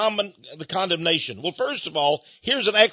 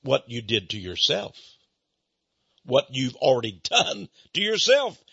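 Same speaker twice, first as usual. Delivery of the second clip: calm reply; 7 semitones lower than usual. Retold or imitated. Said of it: "I very calmly said".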